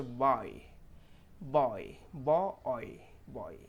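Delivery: "बॉय" drawn out slowly with a full oy glide; clear "oy" sound. Shown as a demonstration. Teacher modelling oy for learners, "बॉय" slow and clear. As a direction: stretch "बॉय" so both halves of oy are heard. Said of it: "ओए sound clear निकल के आ जाए".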